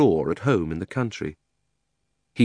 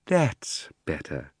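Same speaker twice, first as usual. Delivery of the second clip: lightly voiced old uncle; 3 semitones lower, 3 dB quieter, much slower than usual. Uncle Andrew's voice.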